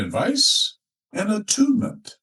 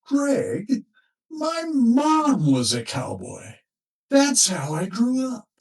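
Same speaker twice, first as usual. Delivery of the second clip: slow, higher, earnest husband confiding; furrowed brow; 11 semitones higher, slower than usual. Retold or imitated. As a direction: lean in and explain slowly, puzzled and sincere. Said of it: "he said with a furrow in his brow".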